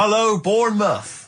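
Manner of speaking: playful DJ hello to the crowd; mock roadshow DJ. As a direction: greet the crowd like a radio roadshow DJ. Pretending to host a roadshow.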